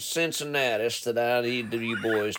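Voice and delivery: drawling Southern trucker boss; appalachian accent. Pretend. Trucker boss voice, Smokey parody.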